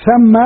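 ثم said thamma, open a after th; ثم with a fatha, thamma, not thumma. Shown as a demonstration. Teacher to students on ثم, clear thamma. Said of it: "بفتح الثاء".

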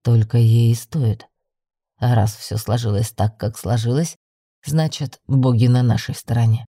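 low, firm male character voice; deep-voiced leader stating his conviction; decided young man. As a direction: settle it in a deep voice. Young man decides she stays.

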